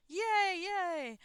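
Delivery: high, loud yay yay; wild cheering. Retold or imitated. Retelling an ad, a man cheering wildly over an envelope. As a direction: cheer like you just won. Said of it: "is cheering wildly".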